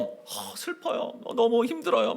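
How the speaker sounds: breathy, half-whispered grief; hushed imitation of a weeping believer; mock weeping. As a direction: play a mourner close to tears, lightly. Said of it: "막 울고 있는 거예요".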